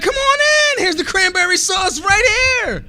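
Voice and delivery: hammed-up, beaming relative calling you in; welcoming family. Family welcoming him back at Thanksgiving, hammed-up loud welcome. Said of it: "They was like".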